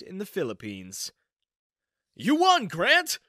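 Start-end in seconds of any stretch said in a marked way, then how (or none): 2.2-3.2 much higher and louder cheering call; excited shout. Acted. Coworker announcing the raffle winner, cheering shout.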